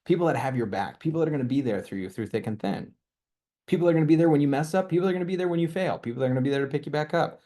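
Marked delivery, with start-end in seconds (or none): 3.7-7.4 higher, fast and rising; rally the crowd, faster and faster; fired-up rapid promises; building excitement. Real, not acted. Rousing pitch to the audience.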